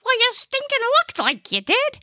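very high, put-out comic voice; indignant Gnesi. Acted. Gnesi defends himself to the old lady, high and indignant. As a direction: protest in a high-pitched character voice.

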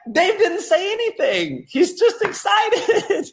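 very high, squealed exasperation; hammed-up indignant whine, voice way up; pissed-off disbelief. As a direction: protest in comic outrage, stretching the end. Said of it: "this pissed me off at the time".

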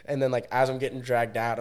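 ordinary talk

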